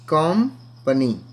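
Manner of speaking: कंपनी slowly as "kam... pani"; syllable split. Shown as a demonstration. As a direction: pause after the first syllable of कंपनी. Teacher splits word into syllables. (कंपनी को तोड़कर, धीरे)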